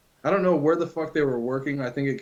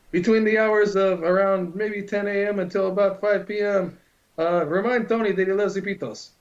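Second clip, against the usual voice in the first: higher put-on announcer read; commercial announcer voice; 6 semitones higher than usual. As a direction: sell it like a cheesy radio ad. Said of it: "I was doing the commercials".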